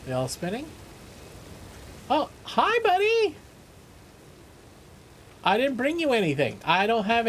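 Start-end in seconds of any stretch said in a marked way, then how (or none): 2.1-3.4 high-pitched hello to a critter; delighted high squeal of hello; pet-greeting coo. Real, not acted. Greeting a creature found in game.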